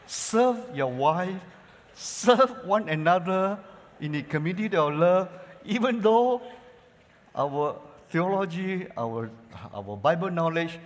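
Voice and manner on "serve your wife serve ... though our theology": slow and loud exhortation; forceful preaching. Acted. Pastor urging church to serve one another, slow and loud. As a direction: drive the command home.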